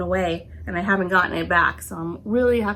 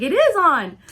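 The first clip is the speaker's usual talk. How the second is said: higher, louder imitation of an exasperated husband; exasperated retort; 9 semitones higher, 6 dB louder than usual. Husband answering wife's air conditioner complaint, exasperated. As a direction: snap back, insisting, with higher pitch and more volume.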